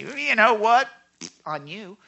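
loud, high "You know what?" dropping to a cold "On you"; the pastor's wrong answer, a spiteful "on you" to someone apologizing; spiteful retort. Shown as a demonstration. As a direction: say it like you're about to get even. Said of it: "I don't say".

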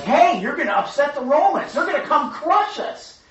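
high, loud mock-panicked shout; alarmed Pharisees. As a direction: cry out in alarm like worried leaders. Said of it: "religious leaders, are like".

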